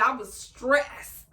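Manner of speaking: ordinary talk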